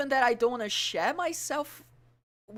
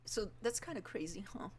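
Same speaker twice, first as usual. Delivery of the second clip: usual speaking voice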